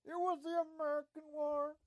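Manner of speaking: high, loud comic outburst; mock outraged shout. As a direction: bellow it in mock indignation. Mock outburst correcting himself.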